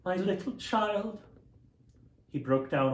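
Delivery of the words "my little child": higher, slow, grief-stricken repeat; broken-hearted. Acted. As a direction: say it again as the grief takes hold.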